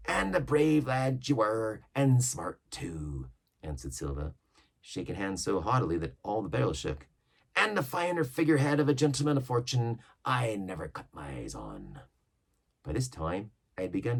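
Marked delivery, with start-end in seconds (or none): 0.1-12.0 lightly voiced old pirate, a touch higher and louder; Long John Silver. Silver yarning to the crew about old ships, mild pirate voice. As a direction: spin the yarn like an old sea cook.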